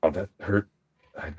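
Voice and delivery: ordinary delivery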